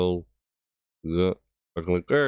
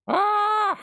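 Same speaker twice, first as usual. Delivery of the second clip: mock villain shriek. Mimics the Mad King's laugh.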